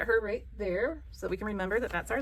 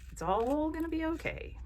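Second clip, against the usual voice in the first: high, slow, drawn-out reassurance; slow singsong comfort, pitched way up; reassuring singsong; 10 semitones higher, 3 dB quieter than usual. Real, not acted. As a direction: soothe them in a high, stretched lilt. Reassuring viewers about the jacket.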